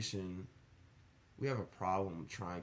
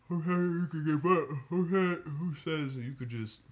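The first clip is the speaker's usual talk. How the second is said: high, loud, mocking whine of someone quitting; mocking quitter voice; 8 semitones higher, 8 dB louder than usual. Mocking viewers who want to quit, high and loud. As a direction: ham up the friend who wants to give up.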